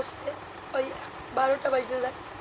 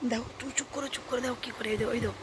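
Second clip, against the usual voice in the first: much softer, hushed commentary; 2 semitones lower, 9 dB quieter, faster than usual. Real, not acted. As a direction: keep your voice down so he won't wake. Quiet so baby won't wake.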